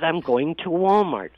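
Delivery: high, loud imitation of Mom; loud, high-pitched retold declaration; emphatic retold announcement. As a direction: imitate a mother insisting, pitched well up. Retold mom's defiant answer on phone.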